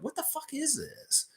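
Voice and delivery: like you can't believe what you're reading; mock disbelief. Streamer reacting to tweets about Scotty ads, squeaky disbelief. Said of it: "I'm like".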